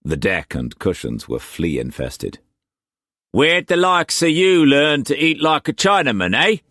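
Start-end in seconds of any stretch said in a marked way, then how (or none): 3.3-6.6 high, loud Cockney pirate-trader voice; Cockney swagger, pitched well up and pushed; harsh Cockney accent. A narrator's reading. Wu Kwok pressing food on Struan, in harsh Cockney accent. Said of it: "the harsh Cockney accent".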